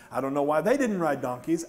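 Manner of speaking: ordinary talk, nothing marked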